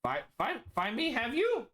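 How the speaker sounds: confused creature voice; Liger's backwards talk. Acted. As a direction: Liger echoing words in reverse order. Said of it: "He speaks like Yoda".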